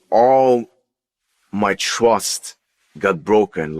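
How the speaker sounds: ordinary delivery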